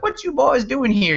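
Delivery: high-pitched attempted Southern waitress; Southern accent. Waitress questions the Northern college kids, attempted Southern accent. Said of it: "I can't do a Southern accent".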